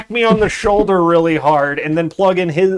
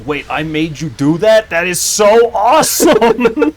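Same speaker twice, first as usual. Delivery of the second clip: slow, higher, louder delight at his own power; gleeful Carl; 3 semitones higher, 6 dB louder than usual. Retold or imitated. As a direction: savor the news like a proud villain. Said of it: "same reaction to that as Carl did".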